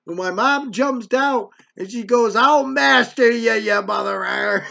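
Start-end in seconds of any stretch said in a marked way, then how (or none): 2.3-4.6 loud, drawn-out mock threat; imitated angry mom. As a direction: imitate a mother pouncing, shouting it slowly. Mom jumps out at the priest.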